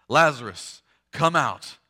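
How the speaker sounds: firm, projected command, each part set apart; loud voice. Acted. Jesus summons the dead man from the tomb, in a loud voice. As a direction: call it out forcefully with a pause between. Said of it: "Jesus says in a loud voice".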